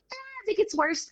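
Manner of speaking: a careless doctor brushing her off; imitating the doctor. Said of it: "that's how he was talking".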